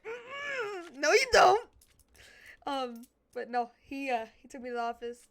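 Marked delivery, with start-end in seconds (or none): 0.0-1.7 loud, high wail of no you don't; mock refusal. Speaker refusing the principal, mock loud whining protest. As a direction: whine it in protest.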